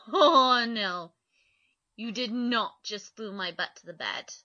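ordinary talk, nothing marked

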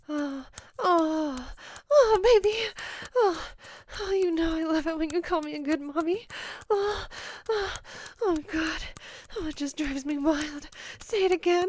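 loud, high, moaning delivery; impassioned and carried away; worked-up passion. Acted. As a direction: let the voice climb with mounting arousal. Acted passion building in roleplay.

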